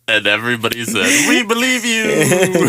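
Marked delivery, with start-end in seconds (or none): 1.3-2.7 high, very slow mocking belief, laughing; mock gullible crowd. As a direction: drawl it out, laughing in mockery.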